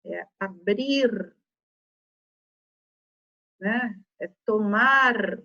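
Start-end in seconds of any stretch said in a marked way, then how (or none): 0.4-1.4 slow "abrir" with a long final R; final R pronounced. Shown as a demonstration. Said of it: "Tem que olhar como que é o verbo". 4.4-5.4 slow "tomar" with a long final R; final R pronounced. Shown as a demonstration. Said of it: "Tem que olhar como que é o verbo".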